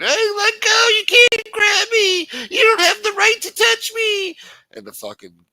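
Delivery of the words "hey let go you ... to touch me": very high, loud shrieking mock protest; mock screaming woman. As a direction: ham up a shrieking, crying victim.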